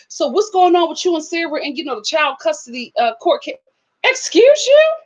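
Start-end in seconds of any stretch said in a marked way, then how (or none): 4.0-4.9 sassy. Pretend. Sassy comeback to nosy question.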